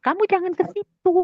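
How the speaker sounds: high-pitched mock telling-off; scolding. In-law forbids her to go there, scolding. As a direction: imitate an annoyed in-law, voice lifted high. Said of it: "dia marah-marah sama aku".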